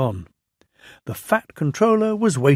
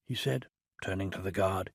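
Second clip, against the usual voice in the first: usual speaking voice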